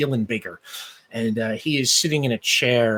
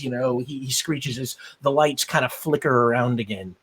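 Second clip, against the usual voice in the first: ordinary delivery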